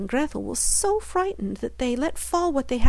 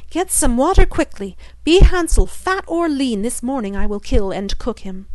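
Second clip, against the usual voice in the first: angry call; 3 semitones higher, 6 dB louder than usual. Acted. Witch losing patience, shouting for Gretel in a passion. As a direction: yell her name in a rage. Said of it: "she called out in a passion".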